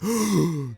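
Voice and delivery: sharp loud gasp of wonder; excited gasp. Acted. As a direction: gasp in delight at the discovery. Excited gasp at seeing suit.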